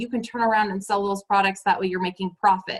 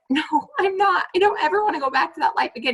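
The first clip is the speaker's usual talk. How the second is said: retold protest; 7 semitones higher, faster than usual. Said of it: "And I'm like".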